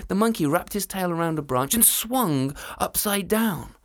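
plain reading voice

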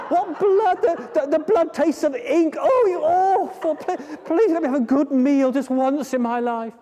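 flea voice, high and desperate, near tears; high pleading flea. Acted. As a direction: beg for food in a high voice. Flea begs.

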